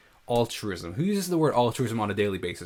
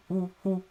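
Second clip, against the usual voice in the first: high choppy woo woo; imitating pulsing synth; 9 semitones higher than usual. Shown as a demonstration. Reviewer showing viewers the tremolo effect, pulsing high woos. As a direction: chop the tone into quick pulses like tremolo. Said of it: "tremolo giving that pulsing woo".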